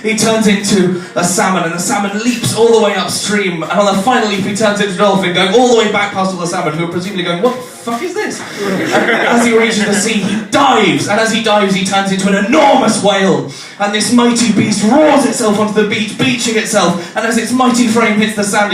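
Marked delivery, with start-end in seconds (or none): 0.0-6.7 breathless, building nature-epic voice; mock-epic narration. Comedian retelling an abstract advert, mock-epic narration. 7.4-8.4 high-pitched squeak of disbelief; baffled outburst. Retold or imitated. Salmon watching a dolphin go past, high baffled voice. 8.4-18.7 breathless, building nature-epic voice; mock-epic narration. Comedian retelling an abstract advert, mock-epic narration.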